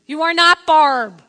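loud, slow "you are NOT Barb"; retold exasperated shout; forceful refusal. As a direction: hammer each word at full volume. Refusal escalated.

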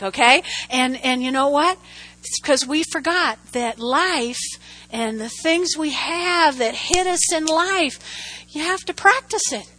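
ordinary talk

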